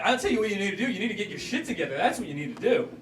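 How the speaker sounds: lightly voiced strict parent. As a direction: scold like a mildly stern parent. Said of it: "my impression of my parents".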